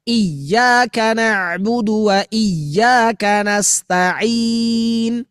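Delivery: iyyaka with the doubled y pressed hard and slow; teacher shows iyyaka with a firm doubled y; stressed shadda. Shown as a demonstration. Teacher demonstrates iyyaka with heavy emphasis. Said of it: "Jadi butuh penekanan".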